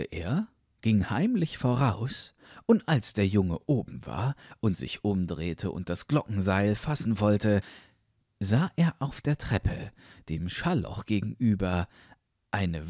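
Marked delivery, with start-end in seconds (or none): none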